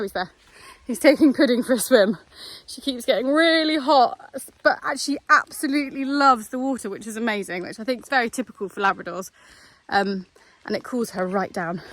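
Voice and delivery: ordinary talk, nothing marked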